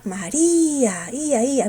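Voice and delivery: MARÍA slow with a long I, then Í-a, Í-a; stressed I. Shown as a demonstration. Teacher isolating the hiatus, stressed I in María. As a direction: draw out the I in "María" and echo "Ia, ia". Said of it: "la cerrada con mayor fuerza de voz".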